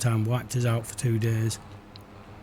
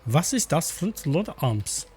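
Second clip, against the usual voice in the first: mock German accent; 5 semitones higher, slower than usual.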